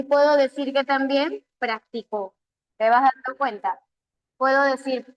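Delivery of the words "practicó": practico stressed on its final syllable, prac-ti-CÓ; stress on last syllable. Shown as a demonstration. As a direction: land the weight on the final CO of practico. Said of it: "practico y practicó".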